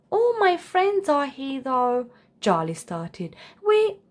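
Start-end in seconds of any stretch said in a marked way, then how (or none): 0.1-2.1 put-on high boyish voice, pleading; a small giraffe protesting; lightly raised child voice; young Charlie. A narrator's reading. 3.7-4.0 put-on high, sudden, louder child voice; high yelp of a child; cut-off child. A narrator's reading. Charlie cut off.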